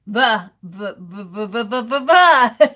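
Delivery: repeated short V buzzes, practicing; drilling the V sound. Shown as a demonstration. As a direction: buzz the V over and over. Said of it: "A hard V".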